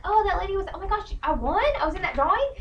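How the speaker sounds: lightly acted happy disbelief; surprised winner. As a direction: imitate someone amazed to have won. Voices winners surprised by text.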